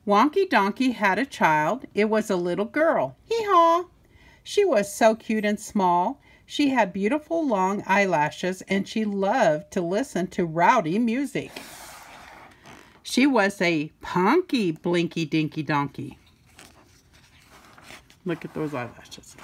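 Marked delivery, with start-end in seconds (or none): 3.3-3.9 high braying hee-haw; donkey bray. Acted. Reader brays for the donkey child. 18.3-19.4 soft aside. Real, not acted. Reader softly shows the child the picture.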